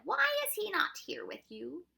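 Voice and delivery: high, loud questioning official; Proc's character voice. A narrator's reading.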